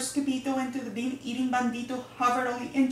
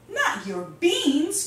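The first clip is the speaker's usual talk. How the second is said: high, loud, drawn-out mock outrage; outraged cry; 7 semitones higher, 6 dB louder, slower than usual. A narrator's reading. Skibito hears the bandit steals the beans, crying out in playful outrage. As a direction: cry out in slow, hammed-up disbelief. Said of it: "cried Skibito, outraged".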